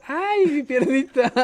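put-on high, slow whiny cry of a little boy; childish wail. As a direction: wail it like a hurt toddler. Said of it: "empecé a gritar en plena boda". (chillido infantil lento y agudo)

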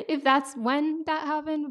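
said through a real laugh. Real, not acted. Imagining finding out in heaven, laughing through it. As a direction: laugh while wondering about it.

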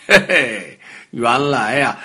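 plain reading voice

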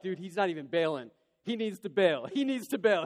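acted-out alarm, building in pitch and volume; retold urgent alarm. Dad urgently willing son to dodge.

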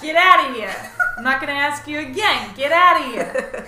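loud mock anger building up; calm turning angry. Acted eviction of clutter item.

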